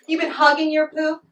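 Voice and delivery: normal talking voice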